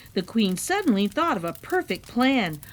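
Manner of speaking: usual speaking voice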